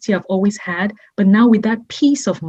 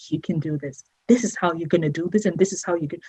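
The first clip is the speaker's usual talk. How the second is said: soft, quiet pep talk, much softer; quietly imitating a friend's reassuring voice; gentle encouragement; 3 semitones lower, 8 dB quieter, faster than usual. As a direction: coax her gently like a coach.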